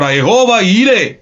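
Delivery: slow, emphatic proclamation opening; preacher prophesying with conviction; prophesying declaration. Shown as a demonstration. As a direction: declare it over yourself in the mirror. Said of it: "കണ്ണാടിയിൽ നിൽക്കുന്ന വ്യക്തിയോടൊന്ന് പ്രവചിക്ക്".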